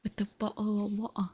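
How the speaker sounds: bottle said as bo'le in a mock British accent. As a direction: swallow the t of bottle, British style. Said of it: "English with a stereotypical British accent".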